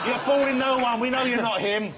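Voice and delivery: high and loud, laughing through the words; laughing teasing. Real, not acted. Host laughing at Brandon's lookalike joke, laughing. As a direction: tease him while cracking up.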